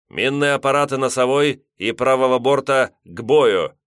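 loud battle command; shouted order. A narrator's reading. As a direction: call it across the deck. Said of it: "приказал командир".

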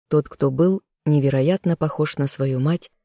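ordinary delivery

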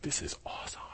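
hushed, breathless whisper of delight; a whispered aside, awestruck; awed whisper. Retold or imitated. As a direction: whisper it like a thrilled secret. Disciples thrilled, quoted aside.

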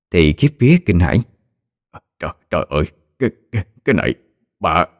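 barely gets the words out, stammering in shock; weak voice. A narrator's reading. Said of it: "cái giọng yếu ớt vô cùng".

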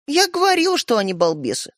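high-pitched start dropping down, resigned; told-you-so. Acted. Sister leaving after her brothers, told-you-so. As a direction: open high and settle into resignation.

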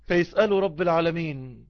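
normal talking voice

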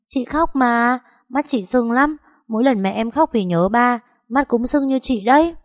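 put-on high, bright-eyed child chatter; little boy voice. Small boy telling the neighbour about school, high child voice. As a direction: voice a small boy eagerly explaining. Child character Đậu Đũa speaks.

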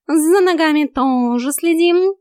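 high, loud, drawn-out goading; needling cat. A narrator's reading. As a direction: needle them like a smug coach. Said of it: "подзуживал кот".

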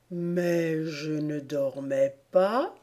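slow, dreamy, vague Dormouse voice; drowsy and far away, dragging the words; vague, strange voice. A narrator's reading. Sleepy Dormouse answering the tea party, vague and strange. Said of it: "d'une voix vague et bizarre".

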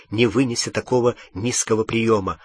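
usual speaking voice

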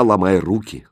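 ordinary delivery

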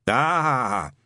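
put-on a suspicious old witchfinder ranting; gruff old sergeant. A narrator's reading.

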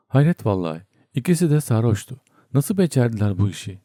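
ordinary delivery

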